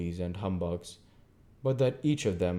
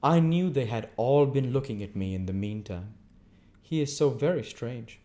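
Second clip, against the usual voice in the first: prim lady; 4 semitones higher, 5 dB louder than usual. Acted. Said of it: "Camilla presently went on".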